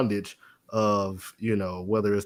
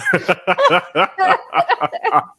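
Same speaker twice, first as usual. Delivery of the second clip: high burst of loud laughter; real laugh; 8 dB louder than usual. Real, not acted. Cracking up at the Doctor Preacher Counselor title, a loud high laugh. Laughing at her own joke.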